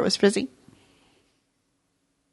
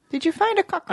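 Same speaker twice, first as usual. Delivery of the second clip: much higher playful question; high teasing; 10 semitones higher than usual. Pretend. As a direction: tease it up high.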